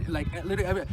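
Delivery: normal talking voice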